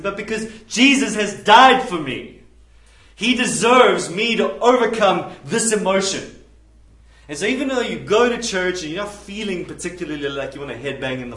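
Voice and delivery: loud, driving sermon delivery; fiery preacher; preaching at full force. Acted. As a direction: hammer it home from the pulpit.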